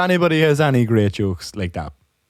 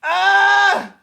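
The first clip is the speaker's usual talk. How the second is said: very high "ah" after laughing hard; spent, high-pitched exclamation; high exhausted cry; 3 dB louder than usual. Real, not acted. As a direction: let out a squeal as the laughter winds down. Recovering from a long laughing fit.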